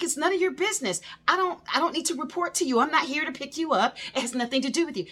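high, fast retold telling-off; mock-angry lecture to the nosy neighbors; exasperated scolding. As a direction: rattle it off, fed up. Driver scolding neighbors again.

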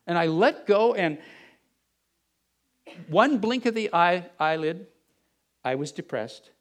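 ordinary delivery

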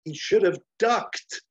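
high, slow, loud hammed-up pun; cheesy hero punchline; one-liner quip. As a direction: deliver it like an action-movie zinger. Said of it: "And Flash says to Baron".

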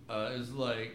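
ordinary talk, nothing marked

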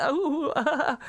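high giggly flustered gasp; flustered giggle. Retold or imitated. Retelling the movie, a high flustered giggle at Stu. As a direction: swoon with a laugh, high up. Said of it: "just totally flustered".

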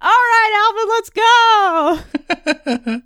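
high, hammed-up battle call; rallying shout. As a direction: holler it brightly to cover the secret. Rallying cry after secret whisper.

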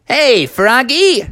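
high, drawn-out excited call; high cartoon greeting; cartoon game character. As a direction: call out slowly as a hyped game hero. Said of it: "this big, crazy-looking guy".

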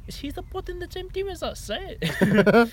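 put-on high-pitched, hammy South African kid; squeaky kid voice with a South African accent. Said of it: "kid South African accent".

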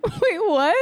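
high-pitched helpless laughter; words squeezed out through laughing; laughing to tears. Real, not acted. Can't stop laughing at reference.

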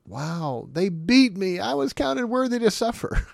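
high, loud overjoyed voice; mock joyful exclamation. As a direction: burst out with joy at being beaten. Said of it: "do you count".

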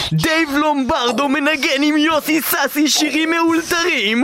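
booming promo read; ad announcer voice. Acted. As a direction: announce it like a radio ad.

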